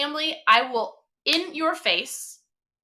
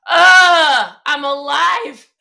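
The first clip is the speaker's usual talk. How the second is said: loud, higher groan into drawn-out words; mock exasperation; 4 semitones higher, 12 dB louder, much slower than usual. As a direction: imitate a loud, dramatic overcorrection, laughing after. Daughter's mock-exasperated correction, retold.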